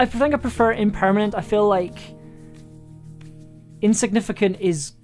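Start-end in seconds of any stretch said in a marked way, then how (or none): none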